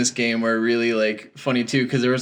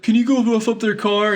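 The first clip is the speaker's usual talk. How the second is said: mock pleading request; 9 semitones higher than usual. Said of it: "So then he's like".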